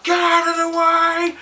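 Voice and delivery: a loud, high, drawn-out road-rage yell; furious biker yelling get out of the way; angry yell. Retold or imitated. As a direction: bellow it at the car blocking you.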